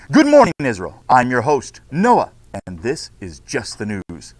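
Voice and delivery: bright, announcing host read; news anchor. Acted. Teacher reads class news script to students, anchor style. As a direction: open the broadcast like a TV host.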